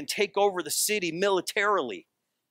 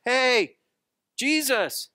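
calling out; 8 semitones higher, much slower than usual. Retold or imitated. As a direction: hail him from the boat. Disciples in boat calling out.

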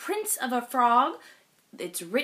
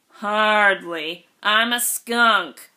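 dry skunk; 6 dB louder, slower than usual. Acted. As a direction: drawl it flatly down. Skunk voice, low and slow.

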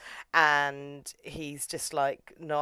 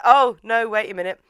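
loud, pitched-up double take; mock sudden realisation; 7 semitones higher, 10 dB louder than usual. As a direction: blurt it as if it just dawned on you. Acts out own sudden realisation.

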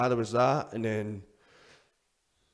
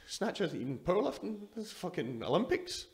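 much higher, mock-outraged disbelief; incredulous scoff; 10 semitones higher than usual. Mocking the trophy's medal.

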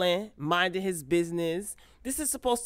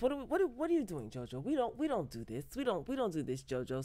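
quieter, fast hushed pleading; imitating a friend hushing an upset man; hushed soothing scold; 7 dB quieter, much faster than usual. Voicing Vanessa calming JoJo.

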